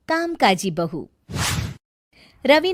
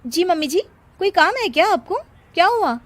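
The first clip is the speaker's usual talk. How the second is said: polite high voice; 9 semitones higher, faster than usual. Acted. Bride sweetly addressing mother-in-law.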